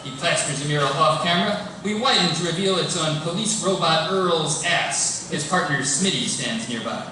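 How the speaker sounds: ordinary talk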